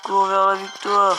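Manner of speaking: loud rallying cheer; excited cheering. Real, not acted. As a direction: cheer the troop on to victory. Cheering on his troop.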